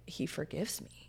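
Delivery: near-whisper, very soft; barely voiced, as if confiding; hushed retold confession. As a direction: imitate her tender, whispered assurance. Retold tearful inmate's confession.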